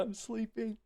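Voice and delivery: ordinary talk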